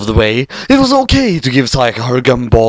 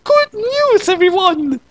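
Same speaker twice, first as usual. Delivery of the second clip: put-on much higher, quavering old professor; Farnsworth impression; slower than usual. Madoka greeting everyone as Farnsworth, high old professor voice. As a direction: announce it like a doddering old scientist. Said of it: "in her best Farnsworth impression".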